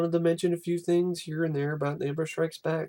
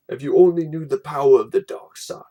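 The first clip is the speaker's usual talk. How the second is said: Vader voice; 8 dB louder than usual. Acted. Vader's storybook line.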